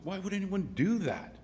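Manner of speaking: high, swooping incredulous question; baffled disbelief. Acted. Saint Peter questions the promoter, in high-pitched disbelief. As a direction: ask it in mock bafflement, pitch way up.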